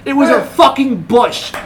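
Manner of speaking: normal talking voice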